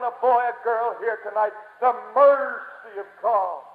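normal talking voice

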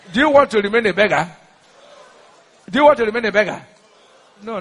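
ordinary talk

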